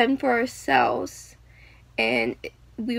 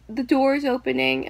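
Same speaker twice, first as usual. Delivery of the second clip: usual speaking voice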